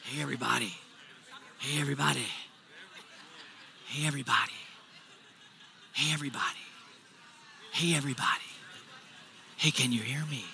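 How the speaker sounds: hushed whisper into the mic. Pretend. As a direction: whisper to grab the crowd's attention. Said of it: "I'm whispering".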